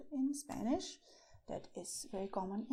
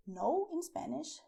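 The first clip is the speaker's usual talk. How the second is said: ordinary delivery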